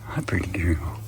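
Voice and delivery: soft whisper to a pet. Real, not acted. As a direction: breathe it gently to the goat. Whispering to goat.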